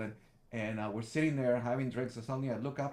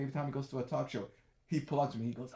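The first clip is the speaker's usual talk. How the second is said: ordinary delivery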